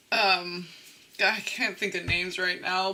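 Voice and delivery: normal talking voice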